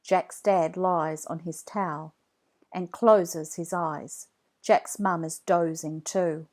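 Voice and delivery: ordinary delivery, nothing marked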